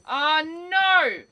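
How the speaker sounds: real loud cry of frustration; frustrated shout. Real, not acted. Streamer losing a fight, yelling in frustration. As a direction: yell it out as the game beats you.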